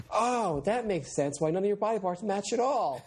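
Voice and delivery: put-on slow, high-pitched girlish realization; hammed-up teenager working it out; teen girl. Girl learns boyfriend is Frankenstein, high teen voice. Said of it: "sixteen-year-old girl is like".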